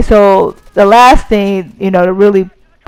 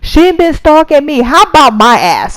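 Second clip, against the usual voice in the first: higher-pitched heated retort; 7 semitones higher than usual. Retold or imitated. Retelling an argument with the boyfriend, heated. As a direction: retell it worked up, pitch raised. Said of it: "that shit got me so heated".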